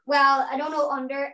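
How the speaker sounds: ordinary delivery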